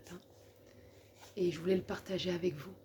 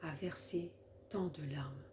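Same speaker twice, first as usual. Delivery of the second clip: solemn, measured poem reading with long pauses; poem recitation; 2 semitones lower, 4 dB quieter than usual. Acted. Woman reading her own poem for the Lord, reciting solemnly. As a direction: recite it line by line, letting each phrase settle.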